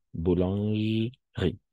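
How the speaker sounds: boulangerie with the middle e dropped, slow; silent e. Shown as a demonstration. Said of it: "Pas boulangerie, sino boulangerie". (boulangerie lenta, sin la e muda)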